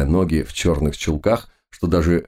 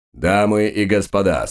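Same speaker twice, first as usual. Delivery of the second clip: booming circus ringmaster; 3 semitones higher than usual. Acted. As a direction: announce it like a ringmaster. Magician greets hall again.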